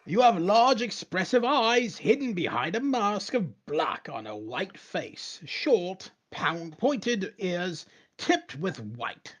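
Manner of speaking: high, slow, drawn-out storyteller; grand game narration. Acted. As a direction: proclaim the description phrase by phrase. Reads game text in grand narrator style.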